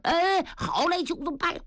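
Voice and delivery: high, cheerful agreement; hearty Niu Gao. Acted. Niu Gao agreeing to the fake battle plan, high and hearty.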